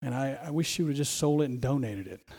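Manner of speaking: soft, quick scheming murmur; lightly voiced Judas plotting under his breath; preacher voicing Judas's greedy thoughts; Judas's inner voice. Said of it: "and he thought".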